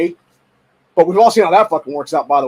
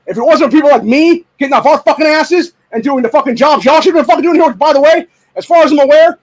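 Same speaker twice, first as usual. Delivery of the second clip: angry yell; 6 semitones higher, 5 dB louder than usual. Real, not acted. Furious rant at gun groups.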